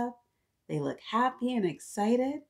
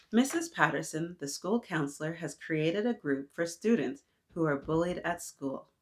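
put-on reading to children; 4 semitones lower than usual. Teacher reading a picture book to the class.

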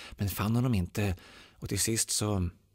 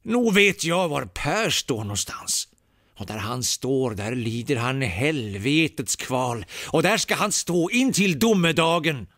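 loud, higher ominous pronouncement; Ulmer's dark answer; 5 semitones higher, 7 dB louder than usual. A narrator's reading. Priest to Per's comrades, loud ominous. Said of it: "han svarade".

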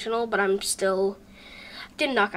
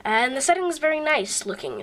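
ordinary talk

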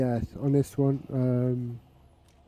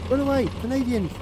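mock alarm call; 8 semitones higher than usual. Calling out to fleeing players in game.

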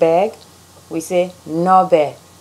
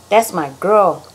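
ordinary delivery

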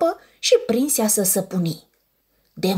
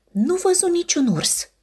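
ordinary delivery, nothing marked

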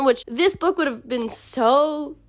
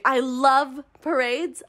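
slow, savoring; much slower than usual. Shown as a demonstration. Reviewer gushing to viewers about parades, slow and savoring. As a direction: stretch out love, slow and drawn.

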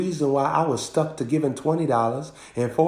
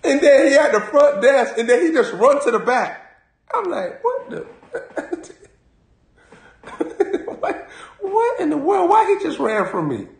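laughing high storytelling; 12 semitones higher than usual. Real, not acted. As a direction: squeal through laughter, much higher. Laughing retelling of shop owner.

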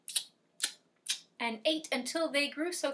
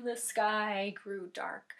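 usual speaking voice

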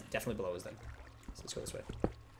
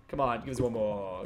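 ordinary delivery

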